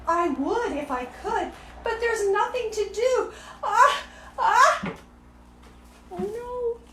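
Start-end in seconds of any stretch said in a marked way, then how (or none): 1.8-4.9 building to a sneeze. Acted. Elephant about to sneeze.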